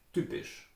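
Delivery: typisch softly, T close to a D; Austrian pronunciation. Shown as a demonstration. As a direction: soften T and P in typisch, Austrian style. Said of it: "In Austria you would say".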